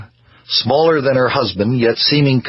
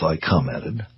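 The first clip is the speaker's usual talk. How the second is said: ordinary delivery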